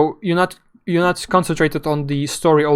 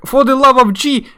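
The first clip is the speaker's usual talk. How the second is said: higher, louder hammed-up exclamation; mock desperate cry; 9 semitones higher, 7 dB louder than usual. Barry crying out as the helicopter goes down, hammed-up shout. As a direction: overplay the doomed pilot's cry. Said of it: "Barry's like".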